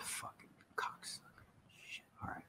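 very soft, voiceless swearing; hushed frustrated whisper; whispered cursing. Real, not acted. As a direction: curse under your breath. Cursing unresponsive poker app.

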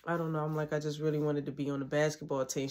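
low, quiet mumbled answer; sheepish, hushed confession; meek excuse. Retold or imitated. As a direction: answer small and low, like a kid in trouble. Child answering principal, in trouble.

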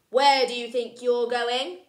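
loud, bossy demand; bossy challenge. Acted. George confronting Luther, loud and bossy. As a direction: challenge the intruder.

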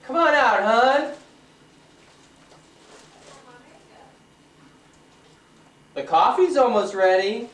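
high, loud call. Real, not acted. Husband calling wife that coffee is ready, loud call. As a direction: coax her out loudly.

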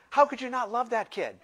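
ordinary talk

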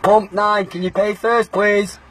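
announcer voice. Acted. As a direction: call it across the forecourt. Calling customer over station intercom.

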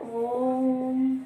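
ओम stretched long, three beats, ओ then ऊ then म; drawn-out ओम, ओ-ऊ-म blended slowly. Shown as a demonstration. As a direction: hold ओम for a long time, like a chant. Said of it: "इसको उच्चारित करके देखो आप".

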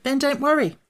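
put-on high, emphatic boyish reply; quick retort. A narrator's reading. Boy to worried Jack, retorting in a high voice. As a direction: toss back a high-pitched reassurance. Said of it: "retorted the boy".

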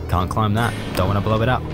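usual speaking voice

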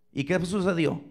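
ordinary talk

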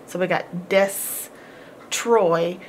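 "des-troy" said slowly with a clear break between "des" and "troy"; split syllables. Shown as a demonstration. Teacher showing the syllable split, slowly broken. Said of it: "the division is right there".

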